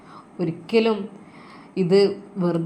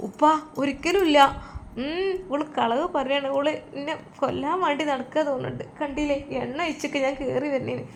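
put-on old mother accusing, high and soft; a high-pitched, hurt old woman blaming the girl; whining accusation; 6 semitones higher, 4 dB quieter than usual. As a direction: accuse her with a wounded whine. Mother accusing daughter-in-law, whining.

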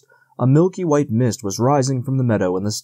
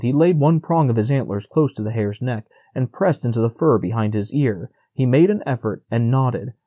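ordinary delivery

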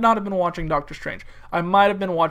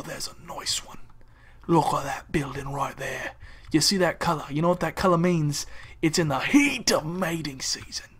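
hushed, low wildlife-host whisper; Steve Irwin impression; 4 semitones lower, 5 dB quieter than usual. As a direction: whisper as if stalking a wild animal. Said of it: "I feel like the Steve Irwin of buildings".

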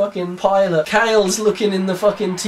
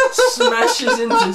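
excited crash; much slower than usual. A narrator's reading. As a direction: voice leaps high and slow on the impact. Narrating crash, voice jumps.

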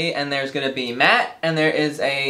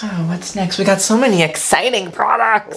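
man doing a light, higher lady voice; 5 semitones higher than usual. Acted. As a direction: put on a prim lady voice for the camera. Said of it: "I lost my lady voice".

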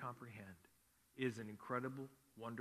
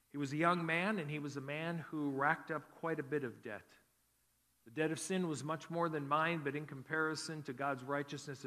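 higher and louder, building sermon voice; rising preaching; 4 semitones higher, 6 dB louder than usual. Acted. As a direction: drive the story home to the congregation. Pastor preaching intro to testimony.

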